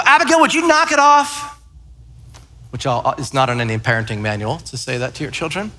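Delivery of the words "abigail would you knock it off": irritated snap. Retold or imitated. Irritated dad snapping at daughter.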